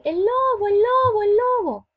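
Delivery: high, loud cry of wolf; shouting for help. Retold or imitated. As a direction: yell it like a scared shepherd boy calling the village. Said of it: "empezaba a gritar". (gritando "¡el lobo!" a todo pulmón)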